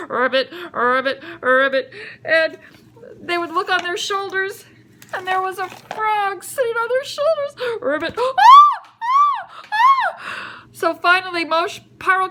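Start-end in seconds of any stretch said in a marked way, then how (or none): 0.1-1.9 low rolled ribbits; frog croak. Acted. Frogs crawl over people in their beds, croaking. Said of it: "crawling all over them and saying". 7.8-8.2 a single rolled ribbit; frog croak. Acted. Frog sits on someone's shoulder, croaking. 8.2-10.6 very high mock screams of fright; short squealed screams, hammed up; frightened shrieks. People find frogs on their shoulders, frightened shrieks.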